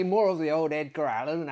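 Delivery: ordinary delivery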